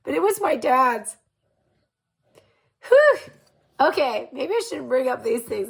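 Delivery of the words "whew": real shaky high whew, overcome; emotional exhale. Real, not acted. Remembering her late dad's jacket, emotional whew. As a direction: breathe out the rising tears. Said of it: "It's making me emotional here".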